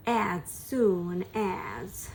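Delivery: slow, careful "As soon as"; slow drill. Shown as a demonstration. Teacher drilling the phrase slowly first. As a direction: spell it out slowly.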